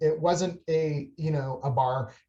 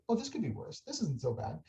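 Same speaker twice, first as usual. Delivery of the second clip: inner voice; 11 dB quieter than usual. Retold or imitated. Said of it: "in my head, I'm going".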